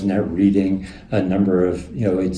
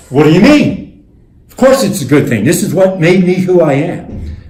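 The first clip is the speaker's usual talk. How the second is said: loud protest; 9 semitones higher, 9 dB louder than usual. Retold or imitated. Presidents protesting back.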